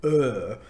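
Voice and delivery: "er" sounded alone as a drawn-out English vowel. Shown as a demonstration. Tutor telling learners what to practise, "er" held as a pure vowel. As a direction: model "er" slowly for learners to copy. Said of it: "practice saying the vowel sound er".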